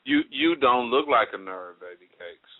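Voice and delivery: smooth it over gently; sweet talking. Shown as a demonstration. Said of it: "let me clean it up a little more".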